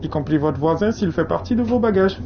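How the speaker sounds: joking train announcement; announcer voice. Acted. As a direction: deliver it like a conductor's announcement.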